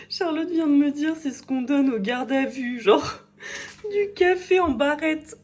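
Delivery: high-pitched giggling through the words; laughing. Real, not acted. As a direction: laugh while telling the joke. Retells joke through laughter.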